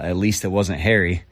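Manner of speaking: normal talking voice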